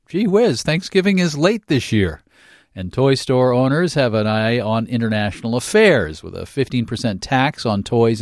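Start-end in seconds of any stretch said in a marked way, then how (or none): 0.1-2.2 higher, sing-song gee whiz; mock exasperated chorus. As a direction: say it like a weary running joke. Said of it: "Shall we all say it again as a chorus?".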